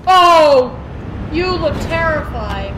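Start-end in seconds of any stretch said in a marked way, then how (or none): 0.1-0.8 sudden loud startled oh; startled shout. Real, not acted. Streamer startled by animatronic, loud gasp. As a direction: jump at the scare.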